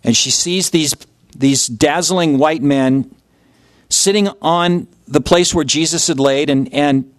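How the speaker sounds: ordinary talk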